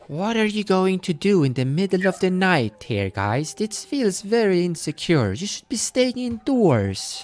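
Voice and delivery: high, slow, fretful townsperson voice; worried villager. Acted. Villager warns adventurers to stay indoors at night, high and slow.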